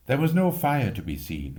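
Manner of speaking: plain reading voice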